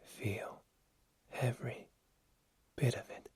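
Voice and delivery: soft unvoiced whisper. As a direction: breathe the words out. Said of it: "he said in a whisper".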